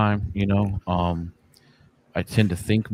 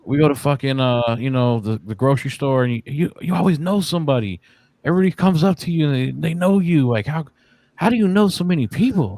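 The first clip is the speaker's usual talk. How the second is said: higher-pitched voice of a puzzled daughter; a light take on his daughter asking, amazed; daughter's voice; 6 semitones higher than usual. Retold or imitated. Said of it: "she's like".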